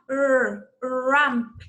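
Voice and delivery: "Ramp" with a curled English R, low and slow; clear English R. Shown as a demonstration. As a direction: model the R in "Ramp" for the class, rolling into it. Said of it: "Remember the pronunciation for letter R".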